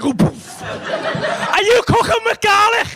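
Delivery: high, drawn-out accusing wife voice; mock wife accusation. Wife bursting in, demanding about garlic, in a high slow mock shriek.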